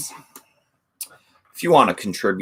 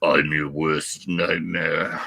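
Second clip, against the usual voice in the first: low, slow tough-guy growl; Cobra quote; 4 semitones lower, 3 dB quieter, slower than usual. Retold or imitated. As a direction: deliver it like a movie action hero. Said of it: "Last movie I watched was Cobra".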